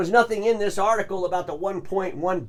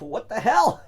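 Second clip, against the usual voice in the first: higher, louder mock outburst; exasperated double-take; retold disbelief; 6 semitones higher, 5 dB louder than usual. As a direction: replay your baffled reaction to the fine print. Acting out his shocked reaction.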